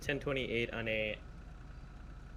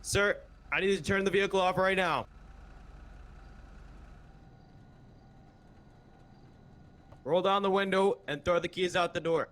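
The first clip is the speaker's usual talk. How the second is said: shouting commands; 7 semitones higher than usual. Acted. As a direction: yell each order at the suspect. Officer ordering suspect out of car.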